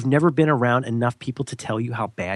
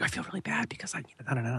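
whispered, much softer confession; guilty whisper; 12 dB quieter, faster than usual. Retold or imitated. Friend admits job interview guilt, hushed whisper.

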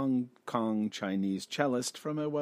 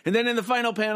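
hammy stage actor; 9 semitones higher, 5 dB louder, much faster than usual. Acted. Mock dramatic reading.

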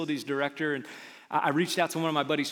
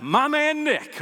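excited cheer; 11 semitones higher, 7 dB louder, slower than usual. Acted. Cheering a friend's text.

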